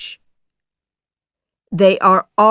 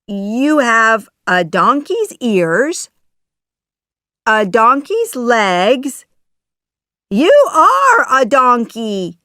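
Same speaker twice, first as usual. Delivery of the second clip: louder, higher storybook amazement; astonished; 5 semitones higher, 7 dB louder than usual. Acted. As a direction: exclaim in growing disbelief. Pinocchio astonished at donkey boy.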